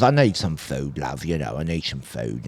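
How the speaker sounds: low, soft pleading mumble; begging addict. Retold or imitated. Crackhead asks a kind girl for food, low soft pleading. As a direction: imitate a beggar, dropping low and quiet. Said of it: "cracker comes up to her and goes".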